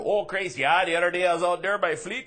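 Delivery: put-on hammy Wisconsin uncle accent. Wisconsin uncle tells about his nephews, hammy Wisconsin accent. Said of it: "that turns into".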